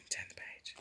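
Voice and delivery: hushed whisper to a little helper; whispered aside. Real, not acted. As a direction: whisper it privately, under the story.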